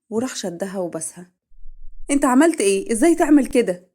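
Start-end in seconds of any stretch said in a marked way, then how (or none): 2.1-3.9 high, loud, outraged cry; shocked outrage. Acted. Wife stunned after her husband kisses her, outraged yell. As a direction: recoil and scream the question.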